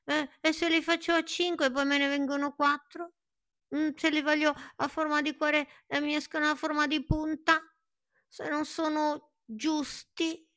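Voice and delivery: high nervous tree listing fears; anxious rambling. Acted.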